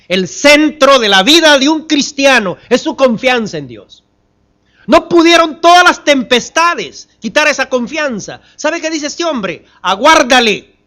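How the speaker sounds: loud, high, driving pulpit delivery; impassioned preaching. Real, not acted. Preacher proclaims Job's unshaken trust in God, fervently. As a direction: hammer out each repeated phrase to the congregation.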